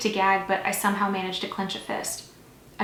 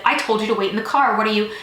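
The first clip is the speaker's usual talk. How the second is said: raised, insistent reprimand; loud scolding; 7 dB louder than usual. A narrator's reading. Officer grabs the driver by the shoulders, loud scolding. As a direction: scold loudly until cut off.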